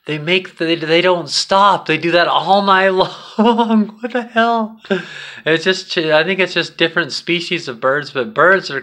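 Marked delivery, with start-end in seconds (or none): 1.9-4.0 laughing. Real, not acted. Birds chirping all night, amused. 4.0-4.8 a laughing what-the-hell at the birds; amused disbelief. Real, not acted. Camper amazed the birds chirp all night, incredulous.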